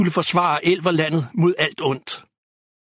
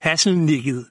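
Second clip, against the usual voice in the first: plain reading voice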